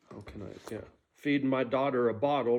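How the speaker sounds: normal talking voice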